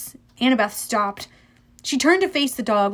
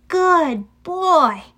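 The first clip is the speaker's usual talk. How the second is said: higher, emphatic praise, each word swooping down; praising a dog; 6 semitones higher, 4 dB louder than usual. A narrator's reading. Annabeth rewards Cerberus for sitting, praising. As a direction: praise a big dog firmly.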